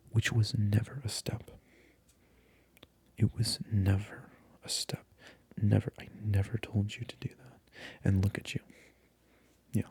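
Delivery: voiced, gentle ASMR murmur, close to the mic; soft-spoken ASMR voice. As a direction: switch from whisper to soft voiced talk, still scolding. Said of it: "we're going to make the switch to soft-spoken".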